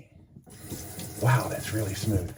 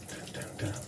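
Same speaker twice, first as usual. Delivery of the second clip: ordinary delivery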